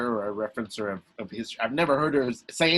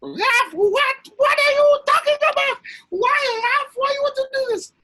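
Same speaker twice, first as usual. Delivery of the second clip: much higher, louder exasperated mother voice; impression of mom; 10 dB louder than usual. Mom reacting to son's bike plan, much higher, loud and baffled. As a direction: shout it as a baffled, alarmed mom. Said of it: "Great impression of your mom".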